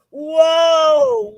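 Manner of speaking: loud high barked whoa; sergeant's shout. Retold or imitated. Sergeant halting a recruit, loud shout. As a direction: stop him cold with a shout. Sergeant stops brother in story.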